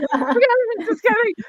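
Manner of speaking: high, rushed just kidding; high flustered backpedal. Retold or imitated. Author to narrator, taking it back in a high squeak. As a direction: squeak it out, embarrassed.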